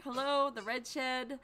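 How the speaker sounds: ordinary talk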